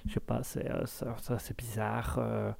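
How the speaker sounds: very soft, half-whispered mumble; mumbled aside. Real, not acted. Streamer mutters an embarrassed aside, very soft. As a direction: mutter it to yourself.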